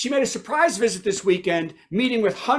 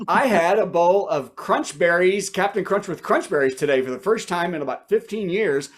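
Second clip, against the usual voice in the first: ordinary talk, nothing marked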